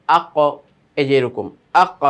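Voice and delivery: আক with the ক released in a bounce; আক, closing ক pushed out with a pop; bounced qalqala. Shown as a demonstration. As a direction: end আক with an echoing knock on ক. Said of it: "একটা ধাক্কা হচ্ছে".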